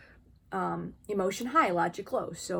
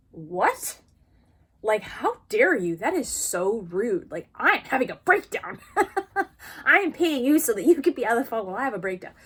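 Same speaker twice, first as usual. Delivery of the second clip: high, loud, indignant retort; huffy how-dare-you, hammed up; mock outrage; 3 semitones higher, 5 dB louder than usual. Client snapping back at her calm therapist, mock indignant.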